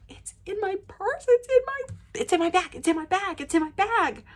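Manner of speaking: high, squeaky rapid repeats, re-enacted; frantic excitement. Telling friend the ball is in my bag, frantic high squeal. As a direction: squeal it like you can hardly believe it.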